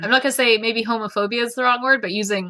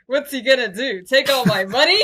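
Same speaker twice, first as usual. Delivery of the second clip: laughing mockery; 5 semitones higher, 4 dB louder than usual. Mocking a line from the book.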